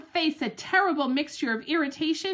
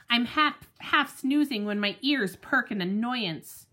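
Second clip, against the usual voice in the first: usual speaking voice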